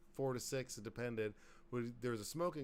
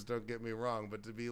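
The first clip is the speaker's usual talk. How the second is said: ordinary delivery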